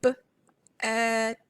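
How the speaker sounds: "b" and "ed" said as two separate sounds with a pause between; sounding out bed. Shown as a demonstration. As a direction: split "bed" into "b", pause, then "ed". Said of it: "if we were going to sound out the word bed".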